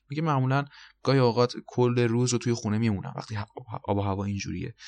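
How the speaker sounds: normal talking voice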